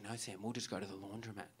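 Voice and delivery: low, soft housemate voice; quiet quoted reply. Retold or imitated. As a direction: imitate a housemate gently suggesting it.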